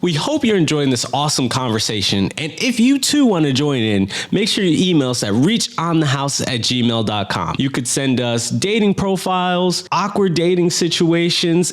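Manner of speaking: high, loud promo voice; ad read. Acted. Plugs email in ad read.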